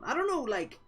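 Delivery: high, loud conflicted outburst; agitated. Real, not acted. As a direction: pour it out in confusion. Reader torn over the scene.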